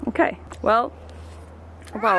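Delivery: normal talking voice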